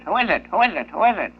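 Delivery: high squawky parrot repeating itself. Acted. Parrot in the joke squawking at the plumber knocking at the door, high and repetitive. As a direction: play the parrot, pitched well up and chirpy.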